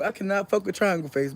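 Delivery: ordinary talk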